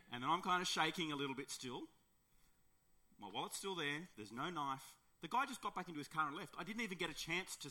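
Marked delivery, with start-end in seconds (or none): none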